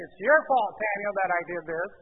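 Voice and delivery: high, loud, accusing excuse; blaming Samuel. Acted. Saul blames Samuel for being late, accusing. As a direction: protest hotly that it is his fault. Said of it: "so he blames Samuel".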